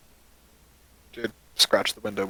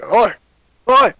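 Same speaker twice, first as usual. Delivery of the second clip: loud rough yelling at an intruder; angry shout; 8 semitones higher, 11 dB louder than usual. Acted. Dwarf yelling at the cat on his window. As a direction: bellow it out across the yard.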